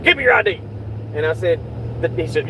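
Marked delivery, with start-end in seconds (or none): 0.0-0.6 higher, mock stern cop demand; cop's demand. Retelling the cop asking for ID: higher mock demand.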